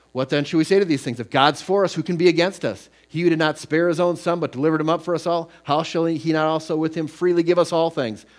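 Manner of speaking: scripture read with rising fervor. Acted. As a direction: proclaim the verses to the congregation. Reads Romans 8 aloud.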